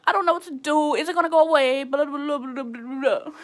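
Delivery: loud, high sobbing plea; crying panic. Retold or imitated. Panicked caller sobbing to family on phone. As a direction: wail it out on the phone. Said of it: "I was like".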